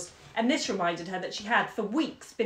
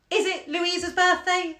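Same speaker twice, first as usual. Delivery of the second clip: high, loud, emphatic challenge; sudden outburst, each word driven home slowly; snapping; 10 semitones higher, 6 dB louder than usual. A narrator's reading. Wife challenging her scolding husband, snapping. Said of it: "she snapped suddenly".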